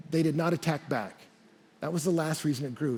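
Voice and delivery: ordinary talk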